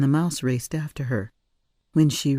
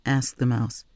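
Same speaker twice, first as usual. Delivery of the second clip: plain reading voice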